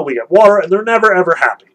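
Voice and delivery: ordinary delivery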